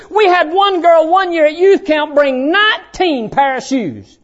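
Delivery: high, loud preacher's exhortation; full-force preaching. Real, not acted. Preacher to kids on free room and board, full-force preaching. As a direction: press it home to the congregation.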